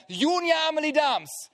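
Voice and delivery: the tone that means trouble; stern parent call. Shown as a demonstration. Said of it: "dann wissen sie".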